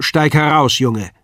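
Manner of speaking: loud, brusque order; gruffer command. A narrator's reading. Father orders his son out, brusque. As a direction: bark the boy out of the box. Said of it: "etwas barscher".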